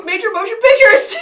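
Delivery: outraged, high and loud; indignant shout. Real, not acted. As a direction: yell it in disbelief. Indignant outburst in argument.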